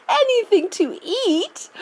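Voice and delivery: high amused laugh then question; delighted eccentric chuckle. A narrator's reading. Wonka laughs, repeats question.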